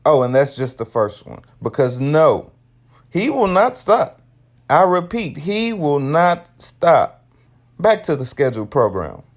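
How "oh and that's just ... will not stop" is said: usual speaking voice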